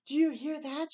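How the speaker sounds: ordinary delivery, nothing marked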